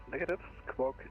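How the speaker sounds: normal talking voice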